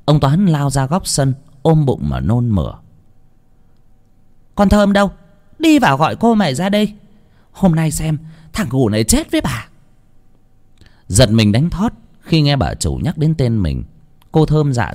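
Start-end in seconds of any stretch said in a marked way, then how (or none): 4.5-9.7 high, sharp summons to a servant; angry mistress. Acted. Mistress sending for her daughter, angry and threatening. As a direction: call out and threaten in a raised pitch.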